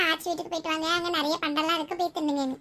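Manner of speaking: lightly voiced little kid; child's voice. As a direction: report Dad's words like a child. Child figure quotes father. (குழந்தைக் குரல்)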